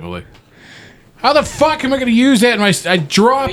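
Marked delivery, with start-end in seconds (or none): 1.2-3.5 high-pitched exasperated protest; indignant outburst. Retold or imitated. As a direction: act out incredulous indignation. Acts out indignant reply to clerk.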